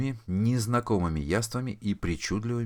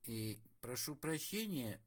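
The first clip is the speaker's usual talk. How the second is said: put-on soft, slow polite old man; 5 semitones higher, 15 dB quieter, slower than usual. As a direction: clear throat and start politely. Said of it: "вежливо кашлянул".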